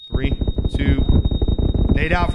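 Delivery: loud callout; grenade warning yell. Real, not acted. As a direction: shout the grenade count.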